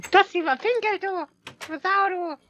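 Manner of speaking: high squeaky mock outrage. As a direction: squeal it in fake indignation.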